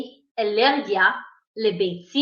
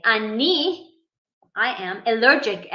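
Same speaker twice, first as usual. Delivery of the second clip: normal talking voice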